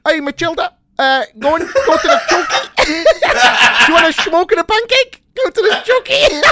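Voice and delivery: high, laughing mock Dutch accent; hammed-up Dutch accent, pitched high; Miss Trunchbull, Dutch accent. Shown as a demonstration. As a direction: put on a silly Dutch villain voice, cracking up. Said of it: "I'd do Miss Trunchbull as Dutch".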